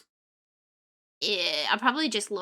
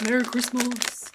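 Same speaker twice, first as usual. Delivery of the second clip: mock festive greeting. Same greeting again, much lower.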